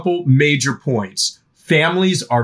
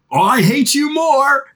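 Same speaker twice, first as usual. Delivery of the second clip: mock snippy parent comeback; 11 semitones higher than usual. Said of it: "And you're like".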